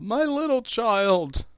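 high, slow wail of grief; breaking down. Acted. Bob breaks down over his dead child, grieving wail. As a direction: cry it out, drawing the words long. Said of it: "He broke down all at once".

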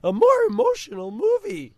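high, loud put-on teen voice; squeaky teen boy impression; Patrick Delmore voice. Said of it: "in that voice".

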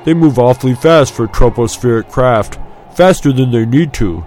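spirited, mild warning; cautioning friend. A narrator's reading. Friend warning Luke about the Skyhoppers, cautioning. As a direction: warn a reckless mate with some push. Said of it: "his friend cautioned".

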